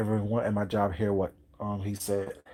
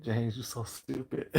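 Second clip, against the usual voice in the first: soft amused scolding; 8 dB quieter, slower than usual. Retold or imitated. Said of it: "I'm like".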